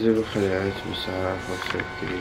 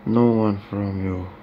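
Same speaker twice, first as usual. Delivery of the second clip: slow dramatic line; 4 dB louder, much slower than usual. Acted.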